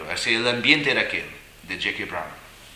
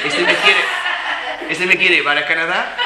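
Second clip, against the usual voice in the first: laughing through the words, louder; 8 semitones higher, 9 dB louder than usual. Real, not acted. Recalling thinking the monk fancied him, laughing. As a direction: crack up while saying it.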